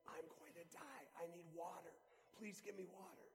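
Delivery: soft, dry, dying whisper of a plea; last breath. Retold or imitated. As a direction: gasp it out as the last words you have. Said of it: "with his last breath".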